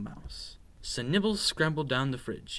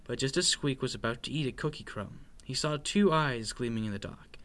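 ordinary delivery, nothing marked

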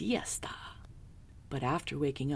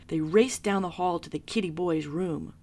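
ordinary delivery

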